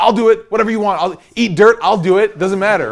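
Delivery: high, loud, over-eager volunteering; eager to please. Retold or imitated. Hanger-on desperate to join the crew, eagerly volunteering. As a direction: offer yourself loudly, hungry for approval. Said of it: "He just wants approval".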